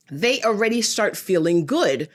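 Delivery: ordinary delivery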